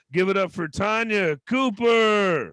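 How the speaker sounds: loud, slow hype-up; introduction call. Real, not acted. Host introducing the comic, loud and slow. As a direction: stretch the name out. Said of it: "introducing comic".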